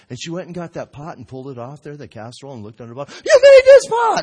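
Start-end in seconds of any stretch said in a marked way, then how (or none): 3.2-4.2 piercingly high, loud squeal of discovery; hyper lady. Retold or imitated. Hyper lady finding the signature under the casserole, amazed shriek. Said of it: "kind of a hyper lady".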